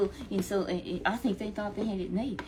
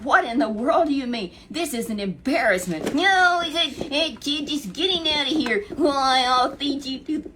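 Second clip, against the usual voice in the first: loud, high taunting giant; mocking giant; 6 semitones higher, 7 dB louder than usual. Goliath mocks young David, loud high taunt. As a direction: sneer and bellow at the boy.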